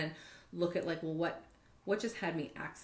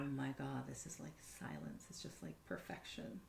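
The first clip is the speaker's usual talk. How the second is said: hushed awe; 3 semitones lower, 9 dB quieter than usual. Retold or imitated. Recalling a moment of inner peace, hushed awe. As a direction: let it drift into reverent silence.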